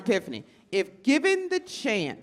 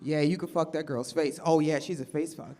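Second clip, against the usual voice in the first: low dude voice; 7 semitones lower, 6 dB quieter than usual. Retold or imitated. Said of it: "they were like".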